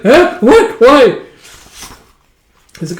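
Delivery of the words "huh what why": high, loud startled yelps; panicked surprise. Acted. Recapper voicing Parya startled by Kamola's visit, yelping high and loud. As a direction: yelp each word in panic.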